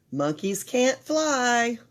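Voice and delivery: ordinary delivery, nothing marked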